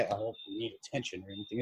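mock needy voice. Imitating kids begging for attention, a soft quick mock whine. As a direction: toss off a needy whine under the breath. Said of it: "it's not like".

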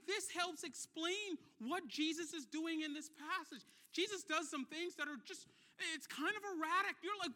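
ordinary delivery

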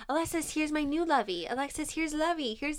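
put-on high, quick little-kid voice; imitating the kids. Kids showing Alexis their new lovey, high childlike voice. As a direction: chirp it like an eager small child. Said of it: "they just kept saying".

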